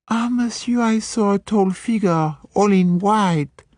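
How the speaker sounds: pert French maid spinning a ghost story. Acted. French maid telling Poirot of the ghost, high French accent. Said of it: "the French maid".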